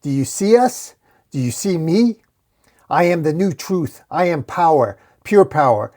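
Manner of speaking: half-screamed boast to a crowd; acted scream. A narrator's reading. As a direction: proclaim it loudly, lifting the pitch. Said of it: "as D J screams".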